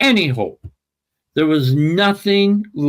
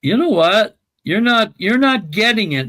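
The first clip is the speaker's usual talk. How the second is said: retold scolding, pitched up; higher, insistent telling-off in a retold quote; exasperated rebuke; 7 semitones higher, 3 dB louder than usual. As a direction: imitate a doctor losing patience. Acts out psychiatrist rebuking him.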